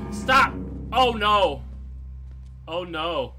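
panicked shout; screaming in terror; scared horror game streamer. Real, not acted. Chased by enemy in game.

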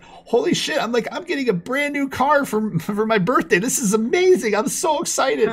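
can't contain the joy, laughing; thrilled. Retold or imitated. Teen gushing about getting a new car, ecstatic.

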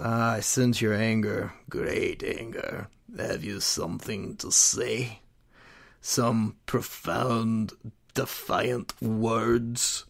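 put-on low, slow, breathy sinister old man hiss; menacing low whisper of a dark lord; Emperor voice. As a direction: purr it out like a scheming old emperor. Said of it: "I can't help doing the voice".

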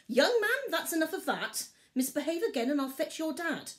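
high, loud warning to her son; stern mum. A narrator's reading. Mother bear warns Barley, sharp and stern. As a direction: lay down the law, high and cross.

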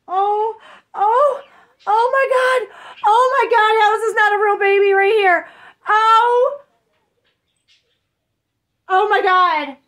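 high, loud gushing over the doll; overwhelmed squeal. Real, not acted. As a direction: let it burst out in disbelief. Overwhelmed unboxing a reborn doll.

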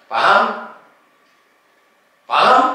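sharp high shout of Paham; shouted demand. Real, not acted. Exorcist shouting at possessed woman demanding understanding, loud. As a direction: bark it at the shaman to force an answer.

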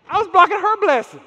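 sudden loud preacher punch; emphatic shout. Real, not acted. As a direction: hit it hard and loud, high.

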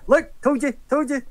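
high, insistent told you told you; high nagging told-you. Acted. Player's character nudging the horse, high and insistent.

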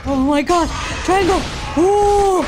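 loud, rising high with excitement; excited exclaiming. Real, not acted. Player landing a finishing move, loud and excited. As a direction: cry out as the fight turns. Winning a fight in game.

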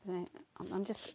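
ordinary delivery

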